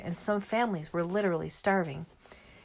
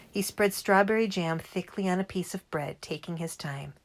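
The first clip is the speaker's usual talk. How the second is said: usual speaking voice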